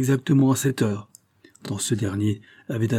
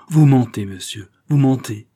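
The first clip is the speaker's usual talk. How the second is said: loud, outraged accusation; furious outcry; 6 dB louder than usual. A narrator's reading. The duke to the count, loud furious accusation. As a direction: hurl the lie back at him loudly. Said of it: "s'écria le duc".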